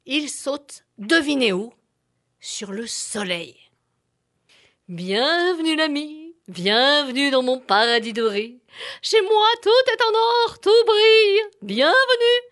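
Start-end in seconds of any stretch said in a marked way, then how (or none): 4.9-12.4 a proud golden sun welcoming. Acted.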